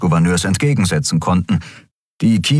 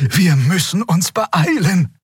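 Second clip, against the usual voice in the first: high, slow, urgent plea; urging warning; 12 semitones higher, slower than usual. A narrator's reading. Xea warning her companions to hurry, urging. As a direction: urge them on in a raised pitch. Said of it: "mahnte Xea".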